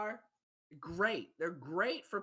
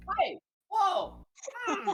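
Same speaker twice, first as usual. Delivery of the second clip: shocked; 13 semitones higher, 7 dB louder, much slower than usual. Acted. Character shocked.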